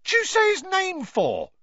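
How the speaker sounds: high, loud and slow, aghast; shocked. Acted. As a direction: gasp it out in horror. Stan shocked at the name.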